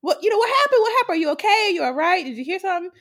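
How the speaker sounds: worried mom. Retold or imitated. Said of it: "my mom was like".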